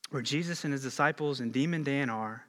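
ordinary talk, nothing marked

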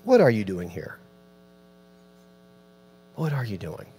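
low, soft, fading question; God speaking hushed to Elijah; quiet voice of God. Acted. God asking Elijah quietly, low and fading. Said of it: "And God says".

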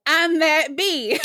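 high, drawn-out brag breaking into laughter; playful mock boast. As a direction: imitate a cocky inner voice, slowly, laughing. Host playfully voices guest's boast.

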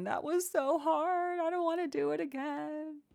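put-on a small hurt child complaining in a high voice; whiny crying baby. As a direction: whine it like an upset toddler. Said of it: "like a crying little baby saying".

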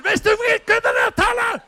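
high, loud outraged yell; mock furious shout. Interpreter mirroring the preacher's indignant yell. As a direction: interpreter echoing the angry shout. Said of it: "Ég var svo reiður".

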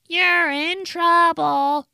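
high, slow sing-song gloat; drawn-out schoolyard taunt; taunting cousins. Retold or imitated. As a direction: tease like a smug little cousin. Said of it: "All your cousins are like".

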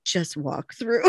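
deadpan security guard, low and soft; bored guard. Retold or imitated. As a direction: wave her through, unimpressed. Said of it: "And he's like".

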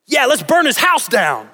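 high-pitched, hammed-up battle cry; over-the-top rallying to her side; mock fired-up. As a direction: egg her on with exaggerated outrage. Mock over-supportive husband's reaction.

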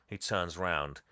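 ordinary talk, nothing marked

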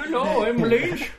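high, loud cartoonish voice; change your voice into a hammy introduction; put-on silly voice. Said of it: "just change your voice".

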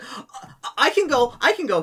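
high, loud nervous offer; the weak priest eagerly volunteering. Retold or imitated. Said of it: "the town priest's like".

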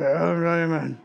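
mock congregation's drawn-out amen; chorus of amens. Preacher mimicking the congregation answering the sermon, drawn-out chorus amen. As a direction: imitate a room of men intoning amen. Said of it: "but a chorus of".

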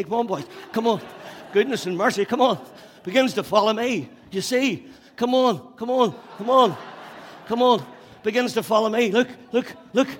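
high, loud shouts while running; excited calling out. Real, not acted. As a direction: egg the chasers on at full voice. Calling boys to chase him.

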